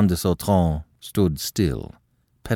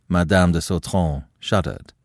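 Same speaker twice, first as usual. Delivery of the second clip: plain reading voice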